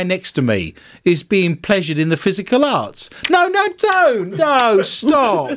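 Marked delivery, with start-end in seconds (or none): 3.3-5.5 high, drawn-out comic pleading; appalled protest. Real, not acted. Host cutting off the co-hosts' joke, appalled protest. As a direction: beg them to stop, voice leaping up.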